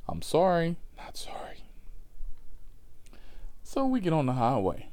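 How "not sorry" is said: hushed, unvoiced aside; whispered aside. Real, not acted. Comic retracting his apology to listeners, whispered aside. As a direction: drop to a whisper to take it back.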